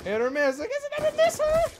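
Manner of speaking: high, squeaky mock singsong; squeaky hit or miss meme voice. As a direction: chirp it like the viral meme girl.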